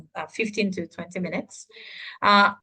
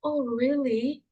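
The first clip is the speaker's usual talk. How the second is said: receive news of a death gently; hushed, sorrowful disbelief; sad news reaction; 4 semitones higher than usual. Shown as a demonstration. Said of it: "how about if someone passed away".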